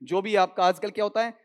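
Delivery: ordinary talk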